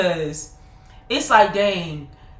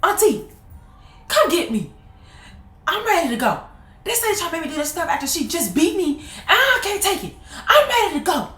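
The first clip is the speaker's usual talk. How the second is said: put-on high pleading kid; high whiny kid plea; pleading child on phone; 10 semitones higher than usual. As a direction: beg the aunt to rescue you. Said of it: "I'm like".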